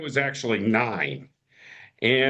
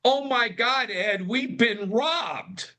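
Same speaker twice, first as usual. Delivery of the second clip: mild mock scream, drawn out at the end; 9 semitones higher, slower than usual. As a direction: imitate mom wailing, held back in volume. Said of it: "we hear this guttural scream from my, my mother".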